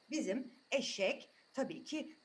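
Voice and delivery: ordinary delivery, nothing marked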